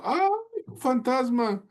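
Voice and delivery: high, scared shriek of ghost panic; high-pitched frightened yelp; frightened cry. Acted. Disciples terrified on the sea.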